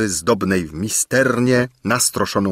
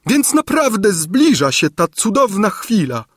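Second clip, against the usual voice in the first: much higher, breathless with delight; gushing old lady; 12 semitones higher than usual. A narrator's reading. Gburia Furia gushing to the king, high voice. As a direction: savour the wonderful moment.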